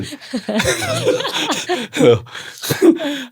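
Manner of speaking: long high peal of real laughter; long amused laugh. Real, not acted. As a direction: laugh delightedly at the son. Laughs at son's remark.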